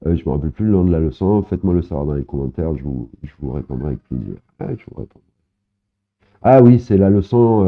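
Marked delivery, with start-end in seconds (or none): 4.6-5.2 breathy whispered aside, nearly voiceless. Real, not acted. Teacher whispering a promise to viewers. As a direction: drop to a whisper, as if to yourself.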